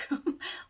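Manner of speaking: quick unvoiced embarrassed laugh; short self-mocking chuckle; breathy laugh. Real, not acted. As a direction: laugh at yourself under your breath. Laughing at own mistake.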